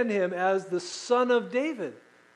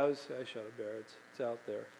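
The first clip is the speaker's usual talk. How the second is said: low, quiet shrug of a report; unexcited; 8 semitones lower, 15 dB quieter, faster than usual. Retold or imitated. Son shrugging off his first bear, quiet. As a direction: mumble it like nothing happened. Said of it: "He was not excited".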